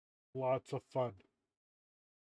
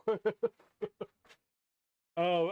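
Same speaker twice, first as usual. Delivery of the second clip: ordinary talk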